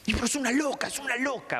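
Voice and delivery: put-on high, exasperated kid whine. Kid protesting to the therapist about his crazy mother. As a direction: whine it like a fed-up child.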